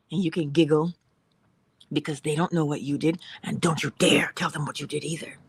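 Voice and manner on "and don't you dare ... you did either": ordinary talk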